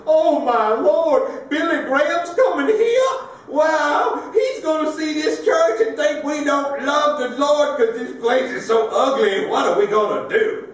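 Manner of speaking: put-on high, loud frantic little old lady; old church lady in a high flustered panic; panicky old lady. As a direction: squeal it out like a fretting church lady.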